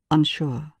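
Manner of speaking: usual speaking voice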